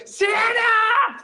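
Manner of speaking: very high ecstatic mock scream; hammed-up Elf freak-out yell. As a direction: shriek it like an overexcited man-child. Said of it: "how much he's freaking out".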